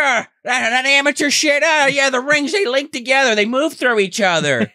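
mocking the magician's tricks as a rude heckler. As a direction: jeer like an obnoxious audience member. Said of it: "He's like".

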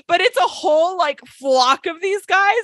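high-pitched, laughing through the words; real amusement breaking into the speech; laughing while telling. Real, not acted. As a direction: tell it while cracking up. Cracks up at her own story.

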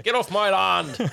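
high, loud, slow angry yell; angry farmer shout. Acted. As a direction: drag out the warning. S1 repeats farmer line slowly.

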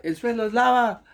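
high-pitched imitation of a friend's public yell; mock shout. Friend yelling a game call before a mall crowd, mock shout. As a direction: shout it high but held back, retelling. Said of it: "Yo voy a gritar".

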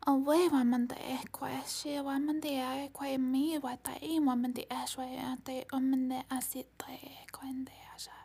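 whispered channeled syllables; channeled light language. Acted. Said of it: "Mantis activation coming through".

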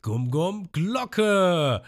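high, very slow drawn-out attack call; attack cry. Retold or imitated. Ruffy attacking Arlong, high slow attack cry. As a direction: drag out the attack name like an anime hero.

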